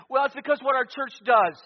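loud, high pulpit rebuke; impassioned, high-pitched challenge to the congregation; full-force preaching. Real, not acted. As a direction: preach it indignantly at full volume.

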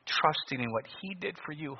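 ordinary talk, nothing marked

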